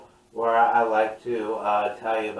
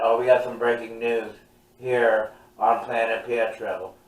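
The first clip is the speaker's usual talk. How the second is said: announcer voice; 5 dB louder than usual. Acted. Mock breaking news read.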